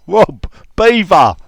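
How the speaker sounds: calling loudly across the office; shouted out. Retold or imitated. Calling to his workmate across the office, loud. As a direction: call his name, then hit Beaver hard. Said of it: "I shouted out to my mate".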